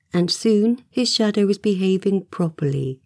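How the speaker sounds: plain reading voice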